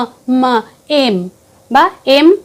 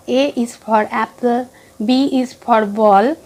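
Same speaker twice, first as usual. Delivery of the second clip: A is for apple said very slowly; slow recitation; much slower than usual. Shown as a demonstration. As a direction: drag the rote chant slowly. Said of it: "এটা যদি বলেও থাকি". (ধীরে ছড়ার মতো)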